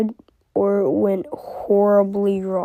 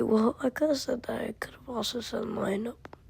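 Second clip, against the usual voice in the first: whispered aside; 2 semitones higher, 8 dB quieter than usual. Real, not acted. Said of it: "whispered aside".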